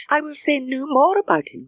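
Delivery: high, exasperated cry; cried out. A narrator's reading. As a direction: throw up her hands in the voice. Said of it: "cried Emma".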